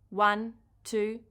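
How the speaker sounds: ordinary delivery, nothing marked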